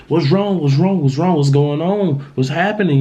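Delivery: high, urgent worried questions; frantic son on the phone, pressing for answers; panicked questions. Retold or imitated. Son on phone with crying mom, high frantic questions.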